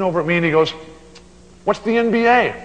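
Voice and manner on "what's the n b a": high, loud baffled question; innocent, clueless dad asking loudly. Retold or imitated. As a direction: play the dad totally lost. Dad's baffled reply, punchline.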